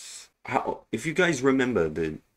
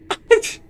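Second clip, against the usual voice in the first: high burst of laughter; 10 dB louder than usual. Real, not acted. Laughing at game death.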